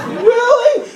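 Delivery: very high squeaky "Really?"; wide-eyed disbelief. Retold or imitated. Answering the emperor voice, high and amazed. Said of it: "I'm like".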